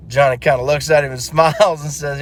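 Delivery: usual speaking voice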